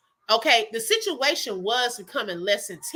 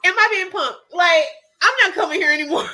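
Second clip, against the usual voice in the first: half-laughing outburst, louder and higher; exasperated laughing shout; 4 semitones higher, 4 dB louder, faster than usual. Retold or imitated. Seeing the same beggar twice, a laughing exasperated shout. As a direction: blurt it out in laughing disbelief.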